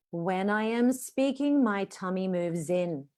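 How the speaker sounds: slow, airy, even delivery; slow breathy teaching line; slow demo sentence. Shown as a demonstration. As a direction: let the air flow out slowly. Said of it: "I'm gonna teach you one sentence".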